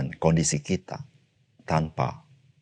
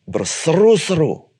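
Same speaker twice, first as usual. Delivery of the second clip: crying out; 9 semitones higher, 4 dB louder, slower than usual. Acted. Preacher acts out the crying out.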